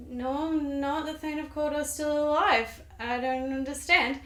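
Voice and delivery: lightly voiced confused protest; puzzled Macbeth. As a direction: play him slightly baffled, not shouting. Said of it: "And he's like".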